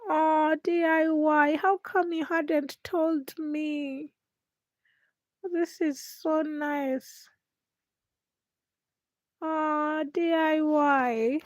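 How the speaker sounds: high, slow, gushing thanks to DIY; delighted surprise. Real, not acted. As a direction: melt over a lovely surprise from a viewer. Viewer DIY surprises her live.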